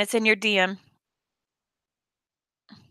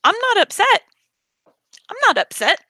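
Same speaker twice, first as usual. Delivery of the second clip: high, swooping insistence; pissed off underneath, pitched way up; pointed denial of upset; 9 semitones higher than usual. Real, not acted. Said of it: "I'm pissed".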